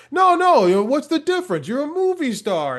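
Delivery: put-on high, excited old Maine man; Maine accent. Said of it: "I love that you did the accent".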